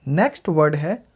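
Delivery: ordinary talk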